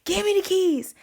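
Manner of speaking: high, loud repeated demand for the keys; furious father yelling at his daughter; dad yelling. Retold or imitated. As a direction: yell it over and over, furious.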